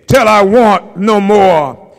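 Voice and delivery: loud, driving preacher's plea, each "offer" hammered; preaching at full force. Acted. Pastor urging the church not to turn the hungry away, loud and driving. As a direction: preach it out loud to the congregation, building.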